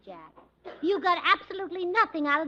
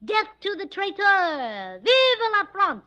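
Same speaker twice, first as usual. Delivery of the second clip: slow, higher, louder battle cry; slow rallying shout, higher and louder; 7 semitones higher, 5 dB louder, slower than usual. Shown as a demonstration. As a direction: bellow it like a charging soldier.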